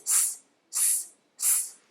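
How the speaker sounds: unvoiced sizzling s; long hissed s sound; hissing s. Shown as a demonstration. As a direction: hiss the s like a sausage sizzling. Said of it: "start with sizzling sausages".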